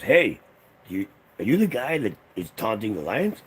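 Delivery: loud, high accusing call; stern park ranger. Retold or imitated. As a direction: call out the troublemaker across the park. Park ranger confronting uncle.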